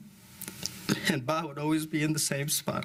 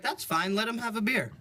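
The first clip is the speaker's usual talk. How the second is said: higher, casual imitation of grandma; breezy grandmother; 9 semitones higher than usual. Grandma waving off rehab to the family, higher and breezy. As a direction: toss it off lightly like grandma.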